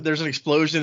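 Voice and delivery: normal talking voice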